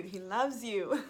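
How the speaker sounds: joy bubbling over as she repeats it; telling viewers God loves them, laughing happily. Real, not acted.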